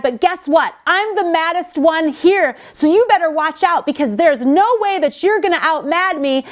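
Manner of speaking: hammed-up angry escalation; angry one-upping. Shown as a demonstration. Speaker demoing a partner who out-mads the other, mock angry. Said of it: "throw it harder than them so that they retreat".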